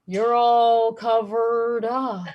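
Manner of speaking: very slow, drawn-out boss impression; exaggerated slow-mo punchline; slow motion. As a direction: drag it out like slow-motion film. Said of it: "he would get really slow motion".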